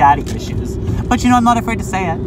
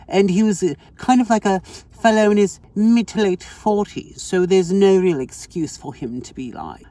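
playful fake English accent; mock-English airs; put-on English accent. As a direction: tell it like an English storyteller. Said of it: "Why am I still talking like I'm English?".

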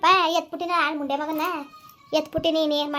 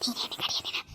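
hushed whisper at the son; 14 dB quieter than usual. Acted. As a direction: whisper a sharp hush. Mother hushes son aside. (ಪಿಸುಮಾತಿನಲ್ಲಿ ಗದರಿಕೆ)

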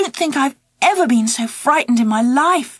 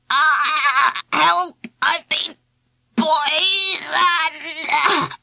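hammed-up poisoned cry; 4 semitones higher, much slower than usual. Boy fakes being poisoned.

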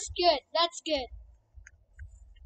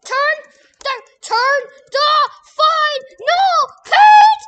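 slow, high, screamed line; screamed quote; 7 semitones higher, 13 dB louder, much slower than usual. Retold or imitated. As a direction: scream it out, drawn out. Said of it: "That's what you say when you get angry".

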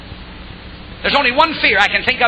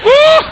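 exultant whoop; 8 dB louder than usual. Real, not acted. Preacher's exultant cry.

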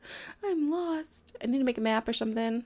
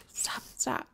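whisper; 7 dB quieter than usual. Real, not acted. Whispered plea.

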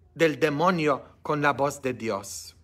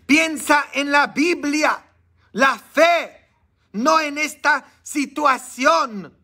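high, loud urging, slowing at the end; loud pulpit exhortation; loud preaching; 11 semitones higher, 5 dB louder, slower than usual. Retold or imitated. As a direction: urge him loudly to think of faith. Retells his advice preaching loudly.

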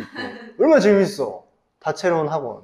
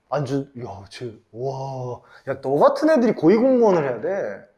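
ordinary talk, nothing marked

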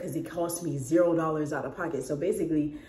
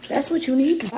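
loud, high mock scolding; imitating dismissive customers; 8 semitones higher, 5 dB louder than usual. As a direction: play a customer telling her off, pitched up. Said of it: "They gonna be like".

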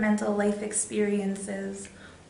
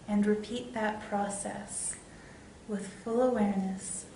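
guided meditation; 3 dB quieter than usual. Real, not acted. Meditation leader guiding viewers toward love and light, guided meditation. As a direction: guide them inward with long pauses between phrases.

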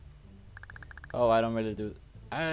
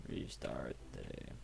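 whisper; 10 semitones lower, 11 dB quieter, slower than usual. Shown as a demonstration. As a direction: Restart the day whispered much lower and softer.

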